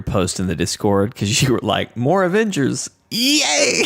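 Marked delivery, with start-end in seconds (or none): 2.0-3.8 high, over-the-top cheer, rising into a squeal; mock-excited cheer. Host reading out co-host's Discord post, mock-excited cheer. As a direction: imitate an over-keen fan's squealed yay. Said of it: "This looks incredibly sarcastic".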